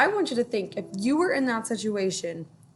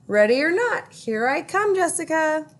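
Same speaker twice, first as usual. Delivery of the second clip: higher, louder playful call; Ruthie's voice; 8 semitones higher, 5 dB louder than usual. A narrator's reading. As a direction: call out during hide and seek. Ruthie playing hide and seek.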